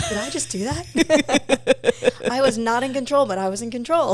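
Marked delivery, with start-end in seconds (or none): 0.1-2.2 slow, loud and higher, astonished; amazed disbelief. Retold or imitated. Asking herself after the vision vanished, slow, loud amazement. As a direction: stretch it out in loud wonder. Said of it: "and I was like".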